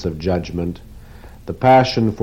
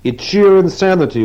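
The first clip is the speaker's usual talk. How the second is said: moaning; 11 semitones higher, 6 dB louder than usual. Acted. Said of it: "Weidler moaned".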